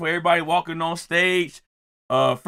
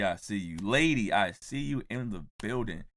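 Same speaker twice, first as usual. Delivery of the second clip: ordinary talk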